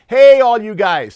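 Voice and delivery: loud, high hail to a crowd; calling out. Acted. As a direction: holler to get everyone's attention. Hailing the leaders in loose translation.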